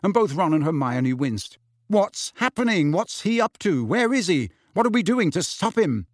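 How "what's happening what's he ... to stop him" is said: furious questions. A narrator's reading. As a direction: fire each question in fury. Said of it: "said Harry furiously".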